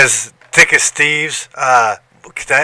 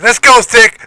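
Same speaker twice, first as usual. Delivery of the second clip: high, loud teasing call; chummy ribbing shout; buddy teasing; 10 semitones higher, 6 dB louder than usual. Retold or imitated. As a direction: call him out, much higher and louder. Buddy calling him Thick.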